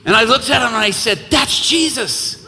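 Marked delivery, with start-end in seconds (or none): 1.3-2.4 emphatic declaration. Retold or imitated. Preacher's answer retold.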